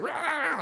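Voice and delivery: a high barking "Rah!" like a snapping dog; dog growl. Retold or imitated. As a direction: bark it out like the angry dog. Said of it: "she goes like".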